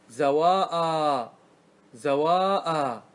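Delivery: "زواء" very slowly, both long vowels held; sounding out. Shown as a demonstration. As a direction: draw "زواء" right out, falling at the end. Teacher sounds out intermediate word form. (نطق بطيء جدًا مع إطالة المد)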